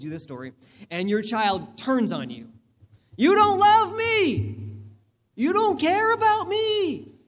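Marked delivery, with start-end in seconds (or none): 3.2-7.1 put-on much higher, slow, whining teen voice; whiny teenager. Teen child accusing the parent, high whiny mock voice. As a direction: play the wronged fourteen-year-old, high and pleading.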